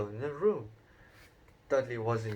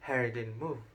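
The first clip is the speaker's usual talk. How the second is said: ordinary delivery, nothing marked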